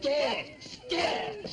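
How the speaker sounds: exasperated shout. Acted. Cop yelling at the doctor about lab dogs, exasperated shout. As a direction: blow up at the doctor.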